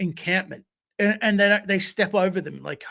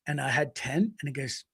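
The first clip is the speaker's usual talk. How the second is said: ordinary talk, nothing marked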